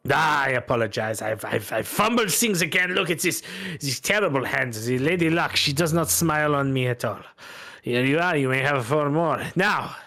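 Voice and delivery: flustered Bretonnian loser; French-accented gambler. Acted. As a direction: lament bad luck in a French accent.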